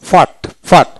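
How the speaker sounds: ordinary talk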